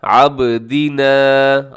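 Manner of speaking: आबदीना with a bounce on the ब, slow; आबदीना with qalqala. Shown as a demonstration. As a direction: let the ब in आबदीना echo. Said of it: "हरूफ कलकला में शामिल है".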